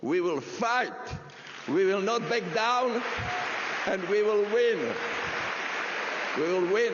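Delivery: rising to a crowd-rousing shout; rally speech climax. Real, not acted. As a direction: punch each promise harder to the crowd.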